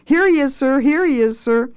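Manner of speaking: high, excited underlings; eager calling. A narrator's reading. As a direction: present him to the boss, loud and high. Boys present Steerpike to chef.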